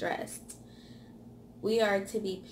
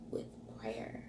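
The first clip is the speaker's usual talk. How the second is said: whisper; 10 semitones lower, 12 dB quieter than usual. Acted. Whispered turn to prayer.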